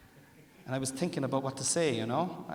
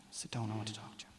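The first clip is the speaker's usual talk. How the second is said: whispered, much softer; wife's grave hushed order; hushed ominous; 3 semitones lower, 12 dB quieter than usual. Retold or imitated. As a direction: whisper it like bad news is coming. Wife's ominous serious talk.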